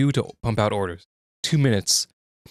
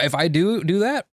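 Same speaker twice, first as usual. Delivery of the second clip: ordinary talk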